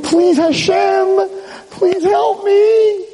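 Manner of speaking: high, very slow, drawn-out begging; stretched-out high-pitched pleading; desperate plea. Retold or imitated. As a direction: cry out to heaven for help. Chaim begs God for help.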